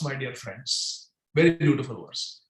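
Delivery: ordinary talk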